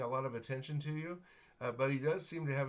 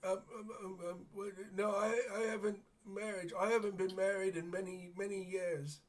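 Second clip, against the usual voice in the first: put-on higher-pitched, muddled old man voice; befuddled old man; 8 semitones higher, faster than usual. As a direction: play a hard-of-hearing old beggar, stammering. GM plays confused old beggar.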